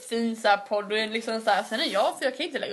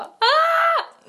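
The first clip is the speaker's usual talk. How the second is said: excited scream; 8 dB louder than usual. Pretend. Friends cheering at the homecoming.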